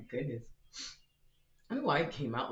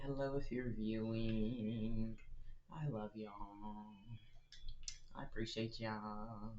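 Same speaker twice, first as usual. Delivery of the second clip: slow, soft and tender to viewers; soft greeting; 5 dB quieter, slower than usual. Real, not acted. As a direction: murmur thanks gently to the audience.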